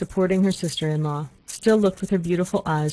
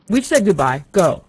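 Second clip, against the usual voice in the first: put-on louder angry old man's shout; furious louder farewell shout; 3 semitones higher, 8 dB louder than usual. A narrator's reading. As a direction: bark it at him to send him off. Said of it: "shouted in a loud, angry voice".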